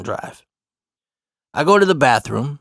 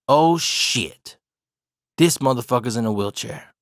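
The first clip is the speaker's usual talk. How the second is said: usual speaking voice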